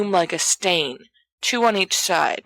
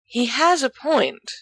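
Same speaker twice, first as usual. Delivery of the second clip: slow, higher measured tone; picking words cautiously; careful; 8 semitones higher than usual. A narrator's reading. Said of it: "Remus says carefully".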